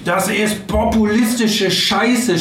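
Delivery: hoch angesetzter, langsam betonter Wutausbruch; geschriene Wutrede. Retold or imitated. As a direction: zornig ausrufen, jedes Wort auskosten. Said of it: "schrie er". (wütend nachgespieltes Schreien)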